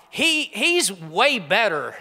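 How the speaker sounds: usual speaking voice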